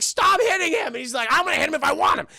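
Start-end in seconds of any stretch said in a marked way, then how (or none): 0.0-0.9 high, loud mock scolding; hammed-up shriek of protest; shouted protest. Wife shouts protest at husband. 1.3-2.3 high, loud mock anger; hammed-up defiant retort; defiant yelling back. Husband yells back in quarrel.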